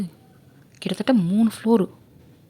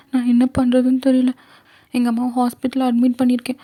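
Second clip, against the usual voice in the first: put-on high, tearful girl's desperate pleading; pleading young girl; 6 semitones higher than usual. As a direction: plead for her sick mother in a high, teary voice. Girl begs for mother's operation. (அம்மாவுக்காக கெஞ்சும் சிறுமி குரல்)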